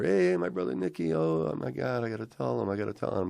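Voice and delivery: high, flustered phone greeting; excited brother. Retold or imitated. Brother hears who is calling and gushes, excited.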